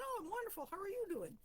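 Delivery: hammed-up cheerful reply to the boss; cheery. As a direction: beam the greeting back at him. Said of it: "I said".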